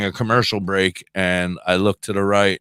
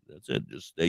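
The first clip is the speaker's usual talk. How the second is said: soft coaxing; 7 semitones lower, 11 dB quieter than usual. Retold or imitated. Coaxing stoned wife while driving.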